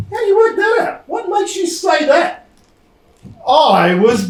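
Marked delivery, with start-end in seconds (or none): 0.1-2.5 incredulous. Acted. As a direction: yell it like you can't believe it. Barry challenges Sam's claim.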